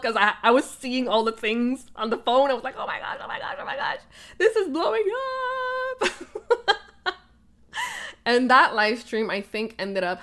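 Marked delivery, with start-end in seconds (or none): 2.8-6.1 breathless gasp then squeaky high laughing; freaking out. Retold or imitated. Watching the comments pour in, giddy laughing panic. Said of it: "I was freaking out". 6.1-6.8 short high giggle. Real, not acted. Remembering the stream blowing up, high giggle.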